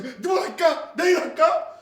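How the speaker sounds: ordinary talk, nothing marked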